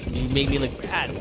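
ordinary delivery

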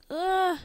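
high "ah" of distress; short high-pitched mock scream; acted cry of alarm. Character cries as vines attack.

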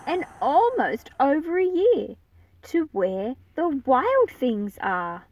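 put-on expressive read-aloud for young listeners; storybook narration. A narrator's reading. Teacher reading the picture book aloud to her class, expressive narration. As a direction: paint the pages with a lively storytelling voice.

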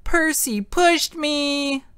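put-on high, slow shriek of a fallen girl; screaming. A narrator's reading. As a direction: wail it out, drawn-out and piercing.

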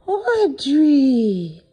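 high, loud, drawn-out sing-song hello; sing-song greeting. Real, not acted. Pet sitter greeting the cat as she arrives, high loud sing-song. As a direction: call out a big happy hello to the cat.

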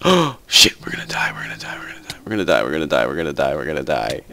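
breathless whispered terror; panicked whisper. Real, not acted. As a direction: whisper the doom over and over. Panic whisper under attack.

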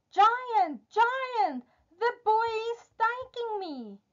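very high, singsong magical harp; harp calling out. A narrator's reading. Magic harp warns the giant of theft, calling out. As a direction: call for help in a high, drawn voice. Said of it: "But the harp calls out".

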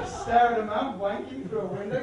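usual speaking voice

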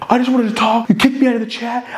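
high-pitched whiny retelling; mock complaint. Imitating the rival kicked from a chat, high mock complaint. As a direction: complain in a voice pushed way up.

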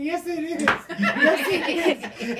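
laughing through the words; giggling while insisting it is funny. Retold or imitated. Laughing as he tells the girls it's funny. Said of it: "And I'm laughing".

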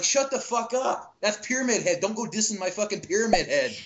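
high-pitched mock outrage; indignant retort. Retelling his reaction to the film heckler, high indignant protest. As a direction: act out scolding a heckler, indignant. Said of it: "And I'm like".

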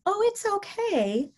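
high, slow sing-song soothing; placating reassurance. Shown as a demonstration. Caregiver wrongly soothing an angry resident, placating. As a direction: coo a drawn-out, patronising comfort. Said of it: "rather than coming and going".

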